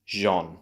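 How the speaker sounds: Jean as a lone "zhon"; Jean isolated, said "zhon"; French-sounding syllable. Shown as a demonstration. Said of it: "Sounds rather French".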